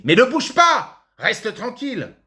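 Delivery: sudden high-pitched outburst; exasperated scolding. Acted. Father interrupting his lesson to scold his son, exasperated. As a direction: snap sharply at a fidgeting pupil, pitch leaping up.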